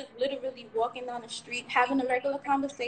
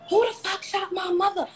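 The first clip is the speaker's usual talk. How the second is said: imitating her son's high-pitched angry outburst; high, indignant retold demand; cursing son; 8 semitones higher, 4 dB louder than usual. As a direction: act out a child cursing in outrage. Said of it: "He was cursing".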